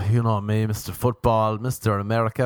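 ordinary talk

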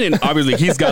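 usual speaking voice

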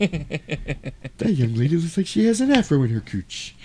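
high cartoon tycoon impression; creepy old boss making a crude remark; Mister Burns. Boss to his assistant about a young lady, creepy. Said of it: "a really weird Mister Burns vibe".

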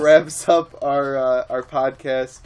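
slow, loud sign-off; podcast sign-off. Acted. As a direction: announce the outro slowly. Podcast outro.

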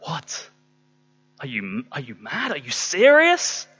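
mock dumbfounded protest; acted disbelief. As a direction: begin softly, then shoot up incredulously. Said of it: "Ananias was like".

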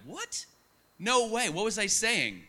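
high-pitched, incredulous retold reaction; astonished. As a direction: react in disbelief, voice leaping up. Retold reaction to startling news.

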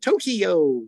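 Tokyo drawn out loud as TOE-kee-yo; Americanized pronunciation. Shown as a demonstration. Joking about mangled Japanese names, exaggerated Americanized Tokyo. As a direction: overdo Tokyo in three American syllables.

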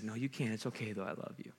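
soft, low and fading almost away; tender reassurance. Acted. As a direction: reassure them quietly, trailing down to a murmur. Voices Jesus gently answering him.

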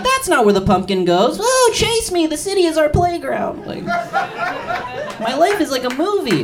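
put-on very high, loud, girly voice; girly date. Date scolding then playful, high and girly. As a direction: whimsical dreamy girl. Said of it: "And she's like".

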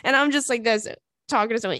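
usual speaking voice

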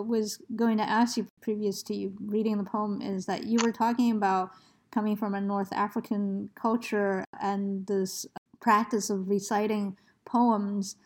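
ordinary talk, nothing marked

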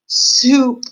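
loud, high, drawn-out moan. A narrator's reading. Roscuro remembering soup and light, moaning. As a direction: wail the word longingly. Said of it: "moaned Mosquero".

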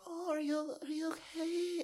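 very high, soft coddling voice; mock soft concern. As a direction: imitate people fussing over someone fragile, high and hushed, drawing out the last word. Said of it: "they're just so soft with them".

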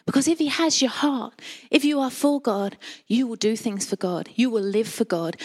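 usual speaking voice

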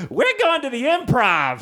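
very high, drawn-out squeal of a sentence; slow, high mock gushing; excited girlfriend. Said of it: "And then she's like".

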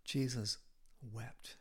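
the preacher's hushed, grave reading; hushed solemn. Real, not acted. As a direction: let "wept" fall away to a hush. Repeated solemn verse.

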